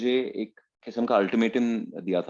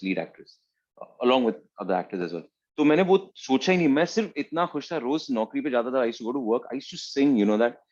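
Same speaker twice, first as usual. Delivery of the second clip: ordinary delivery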